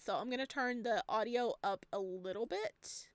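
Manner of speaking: usual speaking voice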